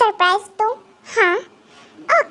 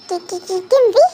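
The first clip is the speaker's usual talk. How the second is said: shocked stammer. Acted. Captive realizes his captor is the psychopath, stammering in shock. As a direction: stammer in sudden terror at the name.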